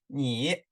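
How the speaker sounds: 你 with a dip and a rise; third tone. Shown as a demonstration. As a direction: say 你 down and up, as a tone model. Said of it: "third tone，yes，right".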